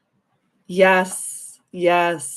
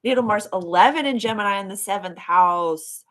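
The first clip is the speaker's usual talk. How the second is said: usual speaking voice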